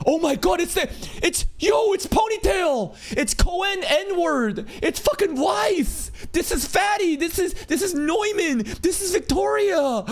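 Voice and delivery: real hyped-up shouting, voice way up; excited recognition. Real, not acted. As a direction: call out each name in mounting disbelief. Fan recognizing characters one after another.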